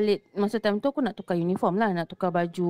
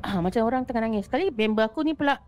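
usual speaking voice

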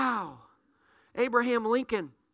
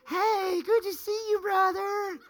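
high, sugary put-on hello; fake friendly greeting; 8 semitones higher, slower than usual. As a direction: overdo the fake smile in your voice. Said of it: "Put that little fake face on".

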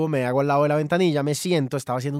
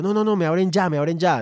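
urgent demand; 5 semitones higher, 4 dB louder than usual. Retold or imitated. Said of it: "me puse un poco grosero".

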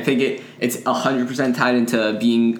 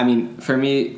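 ordinary talk

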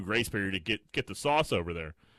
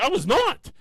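high, loud protest; indignant shout; 8 dB louder, slower than usual. Retold or imitated. Son denying to mom he was going, high indignant shout. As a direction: shout the denial back.

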